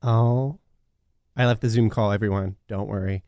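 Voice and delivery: normal talking voice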